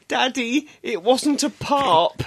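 high-pitched imitation of a child; imitating young daughter. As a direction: play a small child owning up to Daddy. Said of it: "his daughter come up to him and said".

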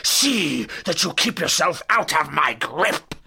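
loud, snarled threat; menacing old villain's snarling bark. Acted. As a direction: snarl a final warning. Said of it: "he snarled".

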